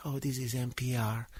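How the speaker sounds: little voice. Retold or imitated. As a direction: lightly mimic a hushed public-radio voice. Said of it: "always at the little voice".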